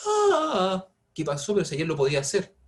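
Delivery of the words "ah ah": sudden jump to a much higher note that breaks; voice cracking on high note. Shown as a demonstration. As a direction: show a singer losing the top note. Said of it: "de repente pierdo el agudo". (gallito al intentar un agudo)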